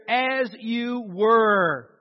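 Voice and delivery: slow, stretched drill order; drawn-out order to troops; military command. Acted. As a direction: call out each word long and deliberate. Quotes the military command again.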